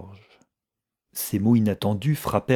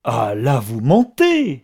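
loud, incredulous accusation climbing high; questions flung out loudly, pitch leaping up; violent outcry; 4 semitones higher, 7 dB louder than usual. A narrator's reading. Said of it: "s'écria-t-elle avec violence".

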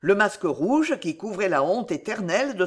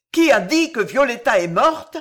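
loud, higher cry with a sob; sobbing cry; 5 semitones higher, 8 dB louder than usual. A narrator's reading. Young man bursting in, crying out with a sob. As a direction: cry out, choking on grief. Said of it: "cria une voix avec un sanglot déchirant".

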